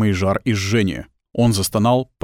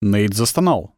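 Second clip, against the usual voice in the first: ordinary delivery, nothing marked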